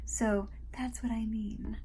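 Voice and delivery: soft unvoiced closing whisper. Real, not acted. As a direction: let it fall to a breathy whisper. Closing the aside softly.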